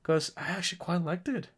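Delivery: high, lilting pitch climbing at phrase ends; unintentionally high-pitched, sing-song delivery; odd high voice. Real, not acted. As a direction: let your voice creep up oddly high. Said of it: "why I'm talking like this".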